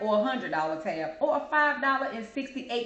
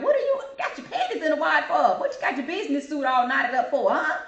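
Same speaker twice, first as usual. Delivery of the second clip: laughing scolding; 5 semitones higher, 5 dB louder than usual. Real, not acted.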